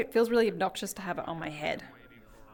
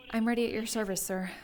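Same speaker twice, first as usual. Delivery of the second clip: lightly put-on American accent; voice-actor American; 5 dB quieter than usual. Recruit reporting to a sir, practised American accent. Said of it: "feels kinda like voice actor-y American".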